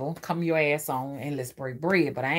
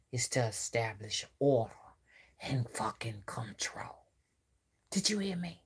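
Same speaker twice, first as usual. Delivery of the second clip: whisper; 7 semitones lower, 10 dB quieter than usual. Real, not acted. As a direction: drop to a breathless whisper. Hushed reveal of a secret.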